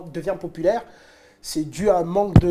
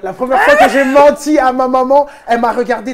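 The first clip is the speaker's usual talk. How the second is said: loud, higher, carefully projected repeat; emphatic repeat; 4 semitones higher, 9 dB louder than usual. Real, not acted. Host repeating phrase for confused guest, emphatic repeat. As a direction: say it out loud so nobody misses it.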